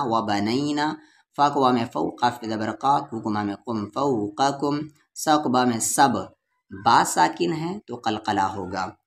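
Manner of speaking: normal talking voice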